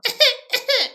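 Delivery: loud, high, comic coughs; Zoolander-style mock cough. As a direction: cough it up in exaggerated parody. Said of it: "I did, like, the total Zoolander".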